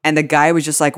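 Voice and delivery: ordinary talk, nothing marked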